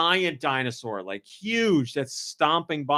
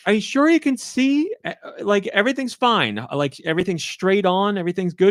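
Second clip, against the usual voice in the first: higher-pitched, sceptical questioning; mock-careful double-check, pitched up; doubtful checking; 6 semitones higher than usual. Daughter and mom quizzing dad on his view, doubtful.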